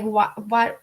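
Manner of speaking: ordinary talk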